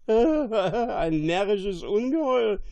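loud, high shout through a laugh; laughing cry. A narrator's reading. Castaway spotting the fool's cap, laughing cry. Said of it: "schrie er auf".

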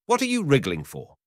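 high scornful taunt; taunting hunter. Acted. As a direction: sneer at him.

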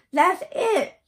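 put-on girl jumping up with a good idea, shouting; excited shout. A narrator's reading. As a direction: shout it, thrilled by the plan. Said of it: "she shouted".

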